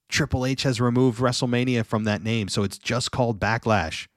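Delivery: ordinary delivery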